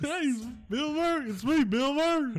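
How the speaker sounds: very high squeaky mock Bill Murray; a ridiculous squeal of the actor's name; Bill Murray impression. Shown as a demonstration. Host mocks his co-host with an absurdly high Bill Murray. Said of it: "That's what he sounds like, right?".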